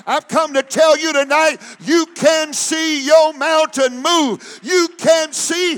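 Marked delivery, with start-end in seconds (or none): none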